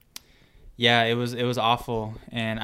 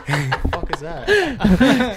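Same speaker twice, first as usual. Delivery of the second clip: slow, high, loud laugh-through question; laughing disbelief; 12 semitones higher, 10 dB louder, much slower than usual. Real, not acted. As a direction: crack up while asking. Reacting to a noise, laughing.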